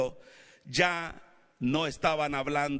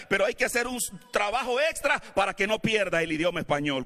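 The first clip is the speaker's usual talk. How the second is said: ordinary delivery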